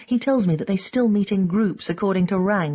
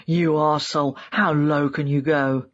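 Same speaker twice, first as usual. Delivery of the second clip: lightly voiced threatening caller, slightly lowered; menacing caller; 3 semitones lower than usual. A narrator's reading. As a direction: read it as an understated phone threat. Acted phone death threat.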